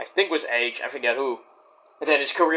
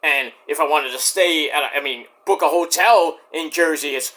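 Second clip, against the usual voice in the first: normal talking voice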